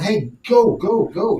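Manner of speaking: half-shouted coaching commands; mock sideline yelling. Coach on the sideline hurrying his lined-up-wrong players, mock yell. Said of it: "I wanna be the one yelling".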